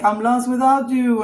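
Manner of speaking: high, loud pleading lost-puppy voice; mock the clingy ex begging; a hammed-up pitiful boyfriend; whiny ex. Ex begging her to come back.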